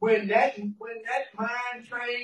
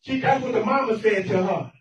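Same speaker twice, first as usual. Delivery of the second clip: normal talking voice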